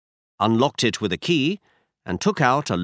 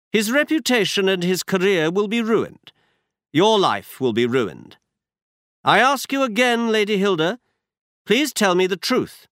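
brisk, higher-pitched detective voice; Holmes voiced higher; 9 semitones higher, 3 dB louder than usual. A narrator's reading. Holmes tells Watson where they go, higher detective voice. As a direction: voice Holmes up high, assured. Holmes warns Lady Hilda.

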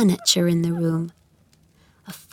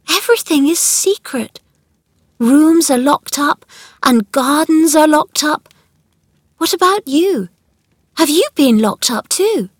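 put-on high, louder girl's voice; curious, puzzled girl; 7 semitones higher, 5 dB louder than usual. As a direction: voice a child puzzling over secrets. Narrator voices Mary.

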